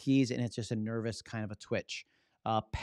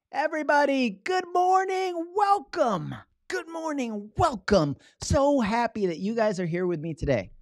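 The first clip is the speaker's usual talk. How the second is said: much higher and louder, joyful welcome; welcoming excitement; 6 dB louder than usual. Shown as a demonstration. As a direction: throw your arms open and welcome everyone. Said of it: "very different than".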